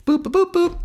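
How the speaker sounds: very high, even keypad boops; phone dialing beeps. Retold or imitated. Playboy calling the third girl, high imitated boops. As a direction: imitate dial tones high up.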